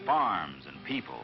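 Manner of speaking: ordinary delivery, nothing marked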